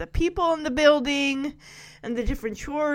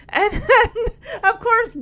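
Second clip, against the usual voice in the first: higher, laughing through the words; 8 semitones higher, 3 dB louder, slower than usual. Real, not acted. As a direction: chuckle as you carry on telling. Laughs while starting the retelling.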